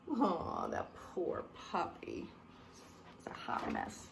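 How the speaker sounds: soft unvoiced whisper of pity. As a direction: whisper it as if the puppy might hear.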